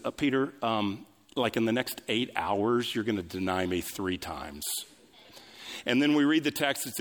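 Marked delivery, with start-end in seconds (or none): 0.0-4.6 low, understated, matter-of-fact prediction; calm. Acted. Jesus answering Peter's boast, very calmly. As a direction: break it gently, dropping low at the end. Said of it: "Jesus very calmly says".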